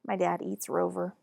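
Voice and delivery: plain reading voice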